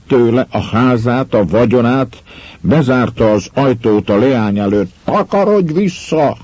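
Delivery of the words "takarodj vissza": much higher, a harsh cry; the father driving his daughter from the door; retold shout. Miller shutting the door on his daughter, a high retold shout. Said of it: "kiáltott rá".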